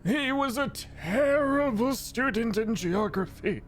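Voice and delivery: high, haughty professor voice; professor orb. Acted. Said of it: "it is a professor orb".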